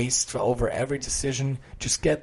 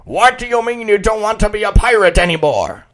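high, louder pirate outrage; indignant pirate; 8 semitones higher, 7 dB louder than usual. Acted. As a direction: bellow in disbelief. Angry pirate uncle voiced.

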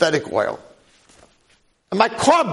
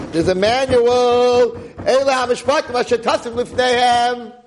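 raised, high-pitched proclamation; preacher's screaming; 8 semitones higher than usual. Real, not acted. Rabbi proclaiming the verse to his audience, screaming. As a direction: cry it out like an impassioned preacher. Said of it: "doesn't like me screaming".

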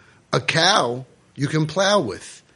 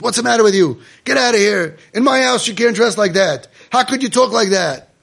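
put-on loud, higher scolding of a rebellious child; angry parent yelling; 7 semitones higher, 5 dB louder than usual. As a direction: yell at the kid like a furious father.

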